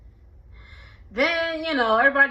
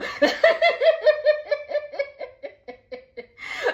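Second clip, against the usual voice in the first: big high laugh. Real, not acted. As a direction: laugh hard, high and long. Laughing at the confrontation.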